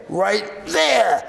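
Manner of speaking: high, loud, triumphant pointing; emphatic shout. Acted. Coyote springing the trick on Winter, emphatic shout.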